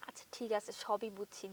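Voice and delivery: hushed, coaxing whisper; a soft whisper to win him over. Acted. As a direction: whisper it gently. Sweet-talking husband into selfie.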